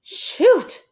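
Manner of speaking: high loud exclamation; mild dismay. Acted. Amelia Bedelia failing to lift the tent, dismayed. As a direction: exclaim in disappointment. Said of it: "said Amelia Bedelia".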